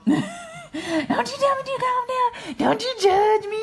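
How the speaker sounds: squeaky indignant sass, slowed; high mock indignant. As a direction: play the offended lady, high-pitched.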